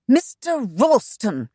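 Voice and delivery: slow, high, loud angry call; crackling with ire. A narrator's reading. Shanna calling out to the agent, slow and angry. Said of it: "Her voice crackled with ire".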